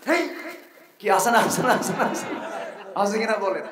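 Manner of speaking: high, insistent repeated questioning; rousing crowd prompt. Real, not acted. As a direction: goad the audience for an answer. Calls on the crowd.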